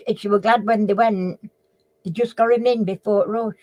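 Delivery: usual speaking voice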